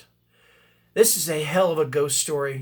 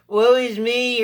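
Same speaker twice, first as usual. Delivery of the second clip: high-pitched mock wail of self-pity; whiny, put-upon moaning in mockery; 6 semitones higher, 4 dB louder, slower than usual. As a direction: mimic a whiny character, raised pitch. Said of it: "were so whiny".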